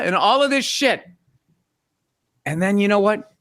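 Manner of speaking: ordinary talk, nothing marked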